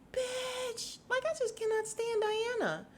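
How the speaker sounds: high, squeaky, exasperated put-on voice; exasperated outburst. Venting to viewers about Diana, high and squeaky. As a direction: fling it out way up high in disbelief. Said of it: "And I'm like".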